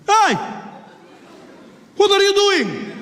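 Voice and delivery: comic outraged yell; indignant challenge. Acted. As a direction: call out sharply to stop someone. Passenger confronts the bomber.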